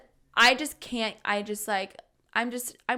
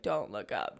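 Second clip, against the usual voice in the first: much lower, hushed warning to herself; hushed self-warning; 14 semitones lower, 7 dB quieter than usual. Retold or imitated. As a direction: mutter it low, eyes on the notepad.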